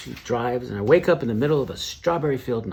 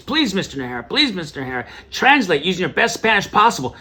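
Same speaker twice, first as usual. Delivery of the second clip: imitated panicky plea, climbing higher and louder; freaking-out stewardess; 7 semitones higher, 4 dB louder than usual. Stewardess begging passenger to translate, mock panic. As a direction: act a flustered stewardess losing it. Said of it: "the stewardess was freaking out".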